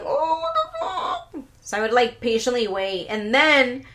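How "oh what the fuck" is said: put-on whiny teen. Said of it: "And I would be like".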